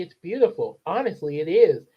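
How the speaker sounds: plain reading voice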